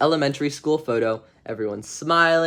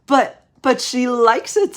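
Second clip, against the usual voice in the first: put-on high, drawn-out kid's whine of protest; protesting child; 10 semitones higher, 6 dB louder, slower than usual. David defends pulling the cat's tail, high drawn-out protest. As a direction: plead loudly in a high little-boy voice.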